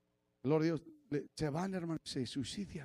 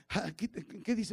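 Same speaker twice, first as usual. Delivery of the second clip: normal talking voice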